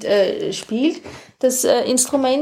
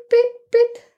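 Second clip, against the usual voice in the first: high, short chirping beeps; high bird chirp imitation; 12 semitones higher, 4 dB louder than usual. Shown as a demonstration. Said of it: "da steht was von Bip".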